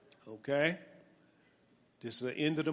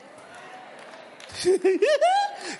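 high, loud burst of delighted laughter; big joyful laugh; 8 dB louder than usual. Real, not acted. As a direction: let out a big happy laugh.

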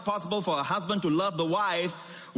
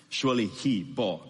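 ordinary delivery

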